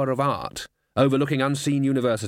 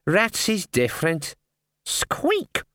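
rat voice; 7 semitones higher, much slower than usual. Acted.

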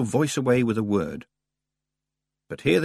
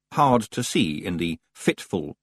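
ordinary delivery

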